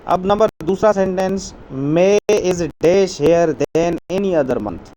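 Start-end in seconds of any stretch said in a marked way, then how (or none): 1.9-4.9 slow dictation. Shown as a demonstration. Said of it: "reads blank exercise sentence slowly".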